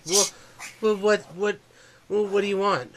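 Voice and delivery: put-on high, stuttering child voice; stammering scared kid. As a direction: stammer like a frightened boy. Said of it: "He's like".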